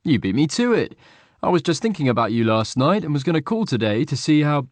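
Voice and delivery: chatty friend happy to hear from him; cheerful friend. A narrator's reading. Will voiced as friend.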